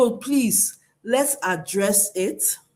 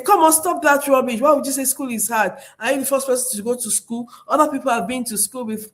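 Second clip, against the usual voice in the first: dismissive scolding; 4 semitones higher, faster than usual. Shown as a demonstration. Parent dismissing a child's complaint about school, scolding sharply. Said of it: "Not by saying".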